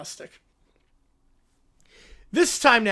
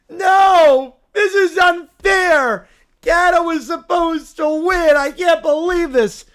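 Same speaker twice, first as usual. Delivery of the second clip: put-on louder, higher toddler yell of outrage; bratty furious shout; 4 semitones higher, 7 dB louder, slower than usual. Caillou yelling at the Americans after Canada loses, furious shout. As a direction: scream it like a sore-loser kid.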